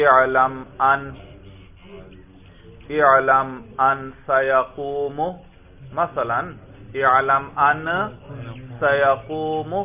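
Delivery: slow and deliberate اعلم ان سیقوم; slow example. Shown as a demonstration. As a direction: spell the example out word by word. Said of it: "مثلا ایک جگہ پر آیا". (آہستہ آہستہ مثال)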